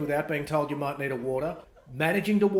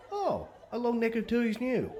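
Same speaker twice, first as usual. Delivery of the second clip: mock surprise; 8 semitones higher than usual. Shown as a demonstration. Mock surprise at another bottle.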